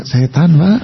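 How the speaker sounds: gentle Solo Javanese, quick and light; refined Solo politeness, ending on a rise; soft Solo accent. Shown as a demonstration. Preacher mimicking Solo people, smooth and gentle. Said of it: "orang Solo juga begitu".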